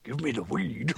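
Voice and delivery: quiet cartoon dog voice; put-on cartoon hound; Scooby. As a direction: mumble it like a goofy talking dog. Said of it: "You want to sound like Scooby?".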